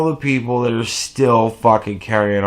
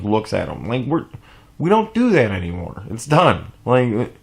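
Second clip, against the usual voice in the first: ordinary talk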